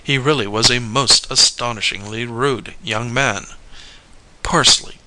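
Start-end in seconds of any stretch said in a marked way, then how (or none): 4.4-5.0 higher-pitched, crisp one-word reply; matter-of-fact answer. A narrator's reading. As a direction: answer brightly in a raised pitch. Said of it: "much as Charlie had done".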